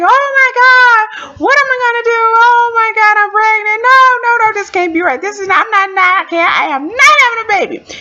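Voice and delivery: high-pitched, loud wailing panic; hammed-up sobbing protest, very high; crying. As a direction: reenact a tearful meltdown to a friend. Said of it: "So I was crying".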